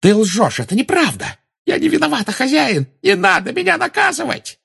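very high, frantic begging; terrified pleading. Acted. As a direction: plead for mercy in panic. Bellatrix begging her master.

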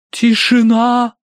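high, loud, long-drawn sepulchral pronouncement; могильный голос. A narrator's reading. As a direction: intone it slowly like a medium from the grave. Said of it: "за могильным голосом изрекла". (могильным голосом, высоко и протяжно)

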